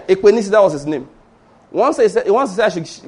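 usual speaking voice

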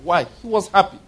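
normal talking voice

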